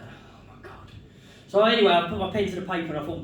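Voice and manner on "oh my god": whispered aside. Retold or imitated. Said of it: "I'm like".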